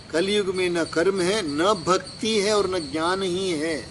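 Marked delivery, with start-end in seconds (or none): none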